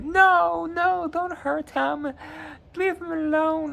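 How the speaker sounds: high falsetto plea, imitating a frightened woman; Gilly pleading. Gilly begging the attackers to stop hurting Sam, high falsetto plea.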